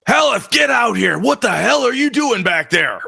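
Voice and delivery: high furious yell at the barkeep; angry shout. Acted. Father pounding the table, yelling for Haleth in fury. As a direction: bellow for him across the tavern.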